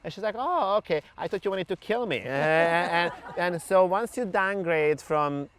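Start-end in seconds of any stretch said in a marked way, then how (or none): 0.4-2.2 lightly voiced client, higher pitch; client's relieved realisation. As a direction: imitate the client calming after a scare.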